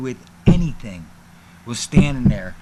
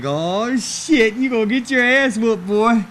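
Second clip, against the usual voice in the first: higher-pitched mock crowd taunt; heckling crowd; 7 semitones higher, 3 dB louder than usual. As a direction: imitate a crowd gleefully warning him. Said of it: "the crowd's like".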